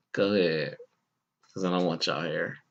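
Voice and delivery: low soft muttering aside; under-breath mutter. Retold or imitated. Thought said low.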